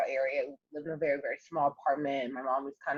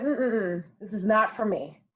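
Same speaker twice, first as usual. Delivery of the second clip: retold reaction; 5 semitones higher, slower than usual. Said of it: "I was like".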